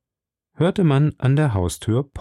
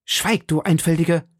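brusque command; 7 semitones higher than usual. A narrator's reading. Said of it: "rief D'Artagnan".

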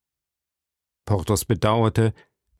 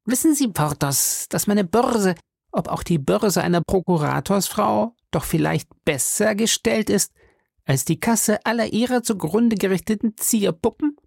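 irritated; 11 semitones higher than usual. A narrator's reading. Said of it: "war gereizt und sagte".

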